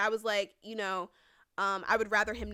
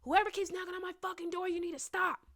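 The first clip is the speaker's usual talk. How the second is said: high, loud yell down a hallway; retold hallway scream; 10 semitones higher, 4 dB louder than usual. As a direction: re-enact yelling at an unknown door-knocker. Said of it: "So I scream in the hallway".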